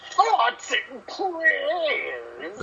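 high, very slow Heyman voice; Paul Heyman impression. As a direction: drag it out like Heyman. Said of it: "I'd rather listen to".

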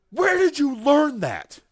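high, loud, slow mock scold; mom yelling. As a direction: shriek it like a shocked mother scolding her son. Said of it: "And, like, yelled at him".

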